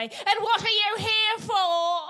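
high, slow, loud questioning; stern librarian. Retold or imitated. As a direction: ask it like a suspicious librarian. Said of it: "the librarian would say".

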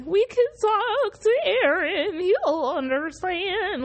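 high, slow mocking singsong; mock Armin's naive line like the meme; mocking SpongeBob voice. Fan mocking Armin with a slow, high meme voice. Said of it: "that mocking meme where it's like".

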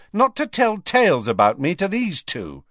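high, indignant scolding; angry. A narrator's reading. Ginny scolds Ron for telling tales, angry. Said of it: "she added angrily to Ron".

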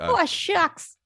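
high-pitched pleading newsboy; old-timey newspaper boy. Shown as a demonstration. Parent showing off a weird voice, high old-timey newsboy. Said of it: "old-timey newspaper boy".

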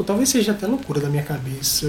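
usual speaking voice

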